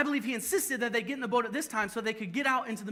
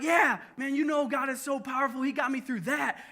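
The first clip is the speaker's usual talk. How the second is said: confident cheer; 6 semitones higher, 4 dB louder than usual. Retold or imitated. Confident believer after past rescue.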